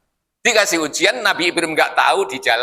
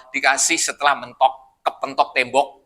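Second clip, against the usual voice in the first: ordinary talk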